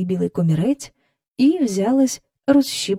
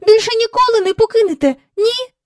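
put-on very high, louder, rushed child's plea; girl crying out; 13 semitones higher, 6 dB louder than usual. A narrator's reading. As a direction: beg in a dismayed little girl's voice. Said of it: "скрикнула Джейн".

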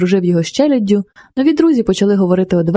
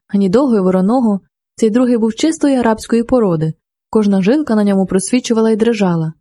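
ordinary delivery, nothing marked

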